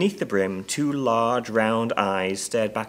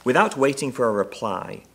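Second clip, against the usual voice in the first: usual speaking voice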